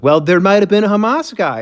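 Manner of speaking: high, offhand mock spokesman; mock spokesperson. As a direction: toss off the excuse as if obvious. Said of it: "And they'll be like".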